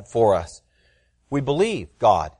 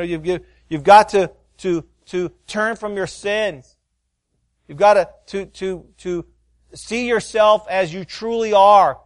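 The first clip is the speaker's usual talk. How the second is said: loud, high, slowing preacher's plea; hard preaching; 9 semitones higher, 5 dB louder than usual. Retold or imitated. Father urging his young children to repent, loud preaching. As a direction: hammer home the call to repent. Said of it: "I'm just preaching".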